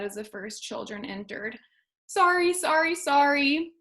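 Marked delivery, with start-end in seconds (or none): 2.1-3.7 higher, hurried sorries; mildly flustered school; embarrassed apology. A narrator's reading. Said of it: "he told them all".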